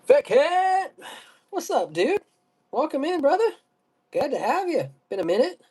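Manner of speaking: excited greeting. Acted. Hyped greeting, high.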